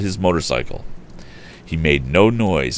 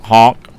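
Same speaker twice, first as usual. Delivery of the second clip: imitated car horn; 5 semitones higher, 8 dB louder than usual. A narrator's reading. Car horn heard on road.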